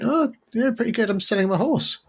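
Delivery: put-on high, chirpy old vicar voice. Vicar answering the buyer, in a high chirpy voice.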